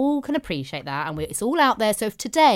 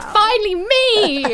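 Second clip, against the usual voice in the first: high, loud drawn-out mock cry; slow, hammed-up wail of relief; mimicked eager friend; 11 semitones higher, 6 dB louder, much slower than usual. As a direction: tease her with an exaggerated squeal. Mimicking friend's relief at being asked.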